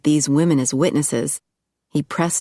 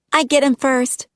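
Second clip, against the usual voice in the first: high petulant claim; 10 semitones higher than usual. Acted. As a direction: stake your claim like a spoiled kid. Girls argue over him.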